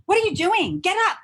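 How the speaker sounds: high, loud, incredulous demand; feisty retort. Retold or imitated. Jersey girl telling bowing ashram crowd to get up, high and loud. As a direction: bark it up at everyone bowing, half laughing. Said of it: "This little feisty girl".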